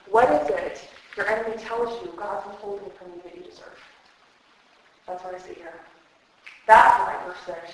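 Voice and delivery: ordinary talk, nothing marked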